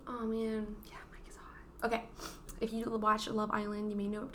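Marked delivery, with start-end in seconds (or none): 0.9-1.8 hushed whispered aside; whispering. Real, not acted. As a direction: whisper it like a secret. Whispered aside about Mike.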